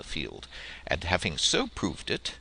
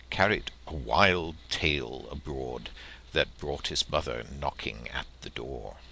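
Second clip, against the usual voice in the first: plain reading voice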